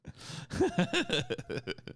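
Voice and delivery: very high burst of laughter. Real, not acted. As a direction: squeal of laughter. Laughs at truth.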